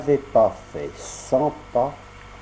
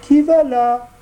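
much higher, louder tiny voice from inside; small voice; 13 semitones higher, 6 dB louder than usual. Acted. A small voice inside answers the knock, high and thin. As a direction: call out thinly through the door. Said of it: "une petite voix".

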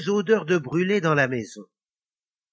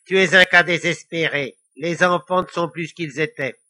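louder devilish grumbling; grating devil; 2 semitones higher, 7 dB louder than usual. A narrator's reading. Devil despairing of today's children, grumbling. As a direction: grumble in exasperation. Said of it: "grinça-t-il".

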